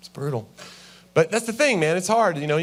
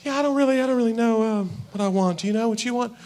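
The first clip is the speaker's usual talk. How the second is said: high, hesitant wife voice raised well above normal; mock coy wife; 7 semitones higher than usual. Wife dodging the order, in a high coy voice. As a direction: play the wife stalling, voice pitched way up. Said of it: "wife answering at restaurant".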